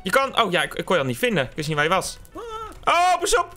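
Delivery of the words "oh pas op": alarmed warning. Real, not acted. Sudden danger warning.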